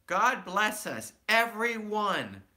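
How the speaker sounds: put-on high, loud child voice; small child voice. A narrator's reading. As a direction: say it like a small sick boy. Tiny Tim speaking.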